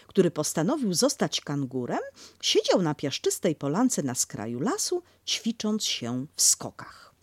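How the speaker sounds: ordinary delivery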